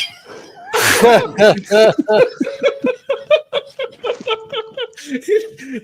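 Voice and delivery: long, loud, high cackling laugh; real hard laughter. Real, not acted. Storyteller cracks up at "Can I come in and pray?", laughing. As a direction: burst out laughing and keep going. Laughing at own retold prank.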